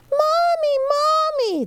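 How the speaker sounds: put-on high, loud scared child calling; frightened cry. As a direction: call out for mommy in fright. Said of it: "they cried as they ran to her".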